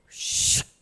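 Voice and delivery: short voiceless whoosh; storm hushing. Acted. Preacher mimics storm obeying Jesus, short whoosh. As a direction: imitate the storm vanishing in one hiss. Imitates storm calming at command.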